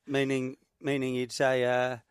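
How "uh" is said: ordinary talk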